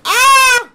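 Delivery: high, loud frightened scream; startled scream. Real, not acted. Scared by clown-like makeup laugh.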